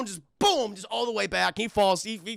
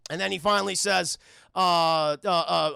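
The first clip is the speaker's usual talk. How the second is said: ordinary talk, nothing marked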